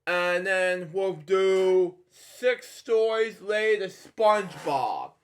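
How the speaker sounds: usual speaking voice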